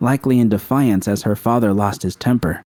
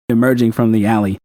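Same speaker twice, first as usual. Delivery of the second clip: ordinary delivery, nothing marked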